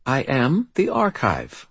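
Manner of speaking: put-on slow, high, self-possessed child voice; steady, assured. A narrator's reading. Child cuts off the doubting wizard, steady and assured. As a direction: repeat it slowly with calm assurance. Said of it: "her voice steady and assured".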